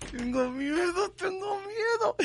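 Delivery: high, slow, fake-crying tengo miedo; mock fearful wail. Radio host faking a frightened cry about the match. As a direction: whimper it like a scared child, hammed up.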